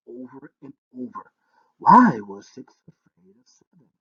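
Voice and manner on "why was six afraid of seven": much louder puzzled repeat; loud baffled musing. Acted. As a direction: turn the riddle over aloud. Prince repeating riddle to himself.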